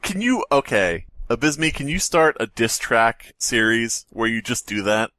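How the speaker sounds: ordinary delivery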